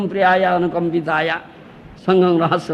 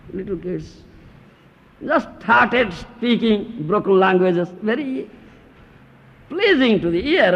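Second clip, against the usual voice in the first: ordinary delivery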